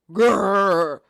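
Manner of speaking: big loud "grr"; roaring mock bear growl; grizzly bear growl. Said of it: "you are a grizzly bear".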